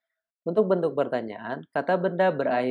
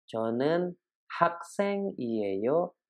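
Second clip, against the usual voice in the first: slow Korean demo; 2 semitones higher, much slower than usual. Shown as a demonstration. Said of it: "Contoh berikutnya".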